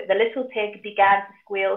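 usual speaking voice

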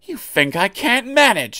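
little engine huffing at a warning; huffily. A narrator's reading. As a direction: sound wounded and indignant. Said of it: "he said huffily".

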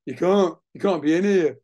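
lightly acted indignant workman; retold workman's warning. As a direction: order them off the site, moderately loud. Retold workman ordering narrator out.

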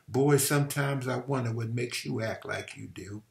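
ordinary delivery

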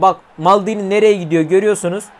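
ordinary delivery

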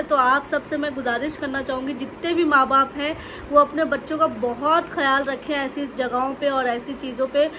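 ordinary delivery

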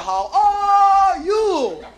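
high, very slow, stretched call; Kansas City greeting. Pretend. Escort leads the crowd in a very slow, high greeting. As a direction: stretch every syllable up high. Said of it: "answer me back".

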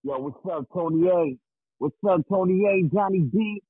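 normal talking voice

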